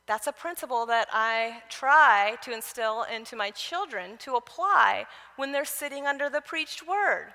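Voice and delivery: ordinary talk, nothing marked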